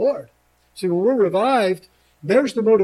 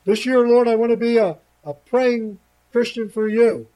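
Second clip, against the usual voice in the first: eager prayer; 5 semitones higher, 4 dB louder than usual. Pretend. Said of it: "prayer commitment".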